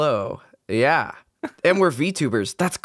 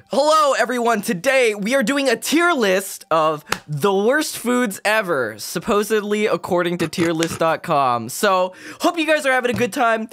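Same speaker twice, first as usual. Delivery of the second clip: MrBeast impression; 7 semitones higher than usual. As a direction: hype it up like a big YouTuber host. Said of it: "doing his best Mister Beast impression".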